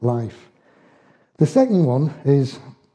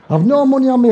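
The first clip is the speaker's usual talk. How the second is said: laughing; 10 semitones higher, 6 dB louder, faster than usual. Real, not acted. Laughing aside about money.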